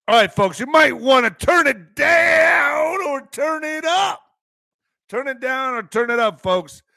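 high, drawn-out announcer yell; hyped intro shout. Acted. Host hyping viewers at the start of the stream, loud and high. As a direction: pump up the crowd like a hype man.

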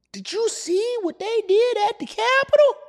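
put-on high old man voice; old person voice.

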